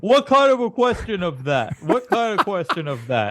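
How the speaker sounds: high, put-on mocking voice; mocking imitation. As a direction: mimic your co-host's garbled question. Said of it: "this is what you sound like".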